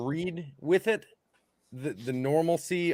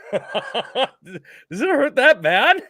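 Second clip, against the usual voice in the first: high, loud, drawn-out laughing question; laughing through pain; 7 semitones higher, 8 dB louder, much slower than usual. Retold or imitated. Teller reliving the crushed hand, laughing in mock pain. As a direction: squeeze the words out through laughter. Laughing while retelling the squeeze.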